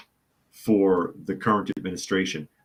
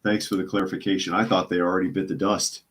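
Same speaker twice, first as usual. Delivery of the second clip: ordinary talk, nothing marked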